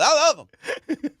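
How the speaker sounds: sudden high, loud "love"; exuberant shout. Real, not acted. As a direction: belt out the affection. Exclaiming love for friends.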